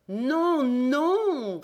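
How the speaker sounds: high, knowing denial; strange smile. A narrator's reading. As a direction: deny it with a sly, amused smile. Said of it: "répliqua Rouletabille avec un étrange sourire".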